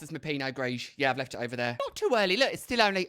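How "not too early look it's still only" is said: high, loud indignant protest. Real, not acted. Defending early wine.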